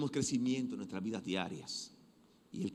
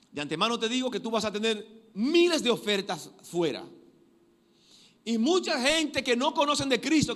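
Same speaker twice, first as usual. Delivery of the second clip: high, loud pulpit preaching; impassioned sermon voice; fiery preaching; 8 semitones higher, 6 dB louder than usual. Acted. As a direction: drive the warning home to the church. Preaching at full force.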